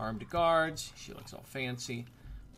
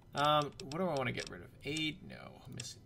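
ordinary talk

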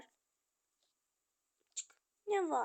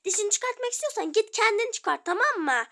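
raised, higher angry snap; angry retort; 5 semitones higher, 8 dB louder than usual. Acted. Sister angrily telling brother to pull it himself. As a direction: throw it back at him. Sibling quarrel.

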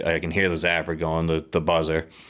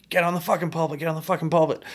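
retold yelling; 10 semitones higher, faster than usual. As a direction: imitate urgent shouts from halfway up the tower. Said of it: "they're yelling at me".

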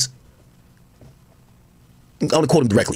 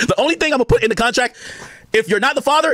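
ordinary talk

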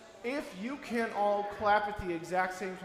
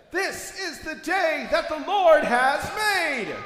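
higher, louder, slow call with Lord punched; 7 semitones higher, 7 dB louder, slower than usual. Shown as a demonstration. Leader redoing the call louder for the children. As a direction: call it out big to fire the room up. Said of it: "Let's try that again".

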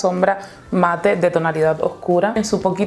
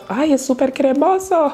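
delighted; 7 semitones higher than usual. Real, not acted. Delighted by creamy eyeshadow.

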